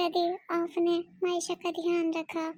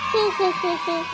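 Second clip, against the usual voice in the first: louder "hee hee hee hee"; cheeky acted giggle; 4 dB louder than usual. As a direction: giggle it out in quick beats. Cheeky giggle when asked about homework. (शरारती बनावटी खी-खी हँसी)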